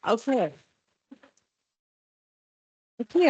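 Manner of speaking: usual speaking voice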